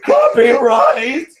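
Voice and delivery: anguished masked wail, stretched out; hammed-up villain's cry of despair, high and slow; Darth Vader. Vader crying out over copyright, melodramatic masked wail. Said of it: "I'll do a better Vader impression".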